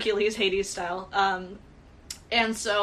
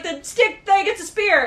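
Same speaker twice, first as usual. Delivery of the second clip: high, loud excited yell; 9 semitones higher, 5 dB louder than usual. Retold or imitated. Rick yelling to Jonathan that it's a spear. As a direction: shout it out like a giddy kid. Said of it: "just trying to scream and".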